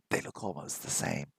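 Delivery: breathy whispered aside; soft whisper. Real, not acted. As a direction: drop to a whisper as if sharing a secret. Whispered aside in lesson vlog.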